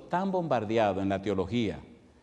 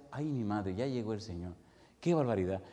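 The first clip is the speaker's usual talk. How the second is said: hushed dream thought; 4 semitones lower, 7 dB quieter than usual. Retold or imitated. His own thought in the dream.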